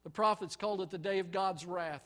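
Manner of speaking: ordinary talk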